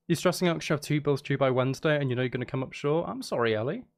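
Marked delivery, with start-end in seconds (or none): none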